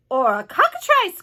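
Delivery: police chief's startled shout; alarmed cry. A narrator's reading. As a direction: cry out a worse guess in alarm. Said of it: "cried the chief of police".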